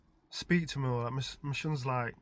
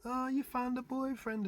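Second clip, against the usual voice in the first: put-on higher, slow teasing girl voice; teasing girlfriends; 11 semitones higher, 4 dB quieter than usual. Friends teasing the girl about his son, slow and higher. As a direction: tease in a sing-song. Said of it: "all laughing".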